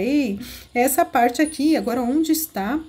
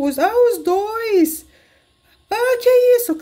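high, louder startled cry of surprise; 8 semitones higher, 5 dB louder than usual. Real, not acted. Reacting to the twin dolls, a sudden startled high exclamation. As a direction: caught off guard as the doll pair misbehaves.

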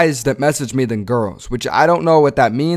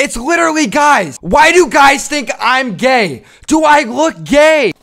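shrill-pitched, slowed disbelief; high exasperated whining; 11 semitones higher, 5 dB louder, slower than usual. Real, not acted. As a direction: rise high and stretch it out in outrage. Exasperated about guys swiping.

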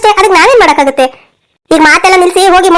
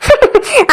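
high, soft scheming chuckle; gloating laugh; 6 semitones higher, 4 dB quieter than usual. Acted. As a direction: chuckle in triumph at the trick. Mother gloats over her scheme. (ಗೆಲುವಿನ ಕುತಂತ್ರದ ನಗು)

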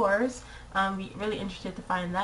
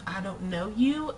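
ordinary delivery

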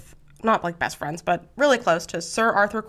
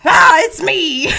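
high, slow, loud announcement; dramatic mock reveal; 7 semitones higher, 8 dB louder, slower than usual. Houdini tearing off his disguise, high, loud hammed-up reveal. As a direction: draw it out for maximum drama.

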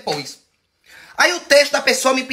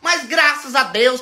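usual speaking voice

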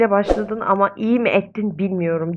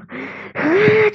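sharp gasp; 12 semitones higher than usual. Real, not acted. Sim wakes the mother.

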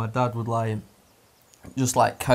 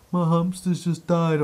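slow, higher mock sob; imitated crying; 4 semitones higher than usual. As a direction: imitate a boy wailing over his pet. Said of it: "James is crying".

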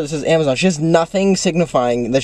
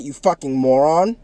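ordinary talk

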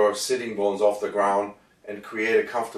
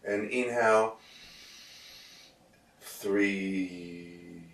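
soft, slow counting on the breath; 3 semitones lower, 10 dB quieter, much slower than usual. Shown as a demonstration. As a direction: breathe in, then barely say three. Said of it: "not verbally the way I'm doing it".

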